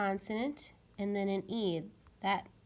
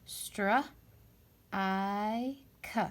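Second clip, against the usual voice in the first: segment by segment, str, i, k; sounding out slowly; much slower than usual. Shown as a demonstration. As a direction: break the word into sounds, str... i... k. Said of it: "So let's try to read this word. Ready?".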